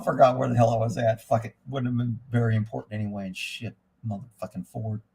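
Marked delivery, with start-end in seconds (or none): none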